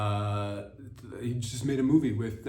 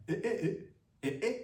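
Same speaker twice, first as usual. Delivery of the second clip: high Nigerian-style eh, eh interjections; playful Nigerian accent exclamation; 8 semitones higher, 4 dB quieter, much faster than usual. Shown as a demonstration. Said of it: "would I be talking like this".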